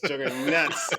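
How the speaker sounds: "Juggernauts" in a Boston accent, r dropped as Juggahnauts; Boston accent on "Juggernauts", no r sound. Shown as a demonstration. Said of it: "With a Boston accent".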